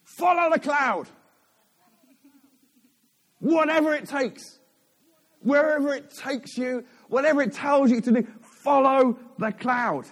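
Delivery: loud, high proclamation; emphatic preaching. Real, not acted. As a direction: proclaim it to the whole room, each phrase set apart. Preacher proclaims his main point.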